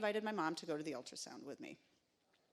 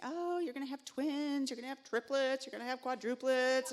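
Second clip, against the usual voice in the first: teasing coworkers; 6 semitones higher, 4 dB louder than usual. Retold or imitated. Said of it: "everybody at work is teasing me".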